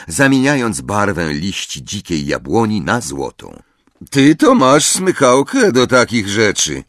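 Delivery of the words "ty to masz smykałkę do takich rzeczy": Ron's voice. A narrator's reading. Ron compliments Hermione.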